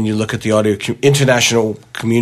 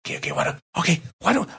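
soft whispered aside, half under the breath; hushed aside; 8 semitones higher, 9 dB quieter, faster than usual. Retold or imitated. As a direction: drop to a hush so the baby isn't disturbed. Hushed Skype aside about baby.

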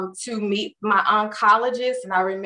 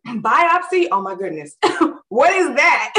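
higher, louder, laughing through the shock; shocked laughing disbelief; 7 semitones higher, 4 dB louder than usual. Retold or imitated. Told she needs a biopsy, laughing in shock. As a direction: blurt it out in startled disbelief with a laugh. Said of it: "And I was like".

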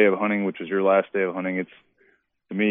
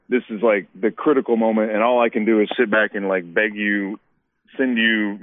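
usual speaking voice

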